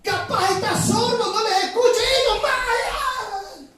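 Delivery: high, loud taunt, stretched at the end; mocking shout. Prophet taunting rivals about their sleeping god, mocking high shout. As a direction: call out as if to someone deaf and far away.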